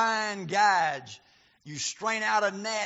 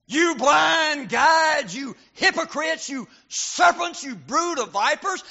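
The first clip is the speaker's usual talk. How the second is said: higher, louder, slow thundering rebuke; fierce shout; 7 semitones higher, 8 dB louder than usual. Shown as a demonstration. Jesus rebuking Pharisees as the preacher hears it, fierce shout. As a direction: hurl each insult like a blow.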